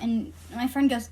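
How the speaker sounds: normal talking voice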